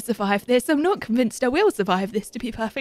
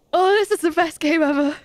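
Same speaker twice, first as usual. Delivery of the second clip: ordinary talk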